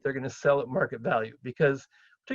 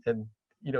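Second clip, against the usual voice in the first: ordinary talk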